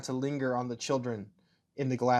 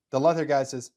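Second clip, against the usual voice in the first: plain reading voice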